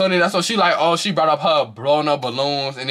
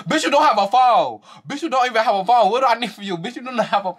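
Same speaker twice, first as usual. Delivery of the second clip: high-pitched, repeated mocking jab; mock argument; 9 semitones higher than usual. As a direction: imitate her taunt, pitched way up. Quote repeated while tickled by it.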